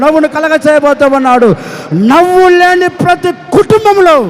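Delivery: high, loud, building preacher's cry; preaching at full force. Acted. Pastor promises the church joy, in a rising shouting preach. Sermon climax, promising blessings.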